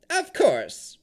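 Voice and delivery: loud, high hag voice; crone voice. A narrator's reading. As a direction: declare it like a proud hag. Hag answers.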